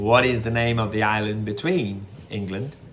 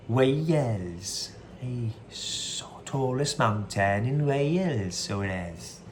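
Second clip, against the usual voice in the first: attempted Welsh accent; 5 dB quieter, slower than usual. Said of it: "I always try and do a Welsh accent".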